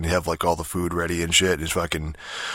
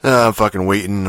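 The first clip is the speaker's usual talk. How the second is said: pushed out louder, fed up; louder complaint; 3 semitones higher, 7 dB louder than usual. Real, not acted. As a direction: lean on it, annoyed. Impatiently waiting for fast food.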